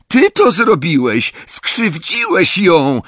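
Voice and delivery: high, loud, slow accusation; a girl shouting at the boy who hurt her sister; angry accusation. A narrator's reading. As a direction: hammer it in as an accusation. Accuses friend after branch falls.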